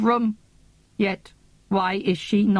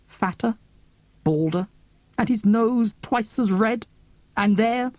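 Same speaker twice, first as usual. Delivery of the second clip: plain reading voice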